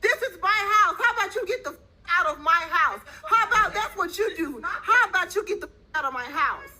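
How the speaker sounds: high furious yelling with laughs; angry shouting. Real, not acted. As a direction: scream at her to get out. Confronting squatter, yelling.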